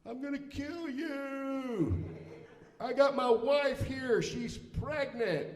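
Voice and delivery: high, slow, drawn-out mock scream; screaming threat. Enraged golfer screaming at whoever hit his cart, mock distant scream. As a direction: imitate a furious golfer screaming from afar. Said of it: "I just hear this screaming".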